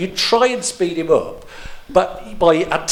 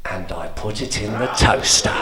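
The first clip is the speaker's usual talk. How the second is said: put-on old man echoing the listener, mildly patronising; patronising old man repeat; 4 semitones lower than usual. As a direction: repeat it back as if correcting. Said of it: "So he'd be there going".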